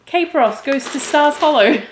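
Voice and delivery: high, loud mock-grand title; jokey announcement. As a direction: announce it like a silly sequel. Jokey title announcement, cracks herself up.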